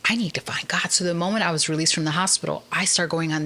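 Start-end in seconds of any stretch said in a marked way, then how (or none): 0.1-0.9 hushed whispered realization; whispered inner longing. Retold or imitated. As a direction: breathe it like a secret discovery.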